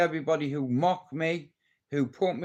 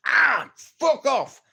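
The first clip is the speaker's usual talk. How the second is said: high, loud outburst; mock exasperated yell; 12 semitones higher, 7 dB louder, slower than usual. Man yelling at imagined mosquitoes, mock exasperated. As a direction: swat it away with a drawn-out yell.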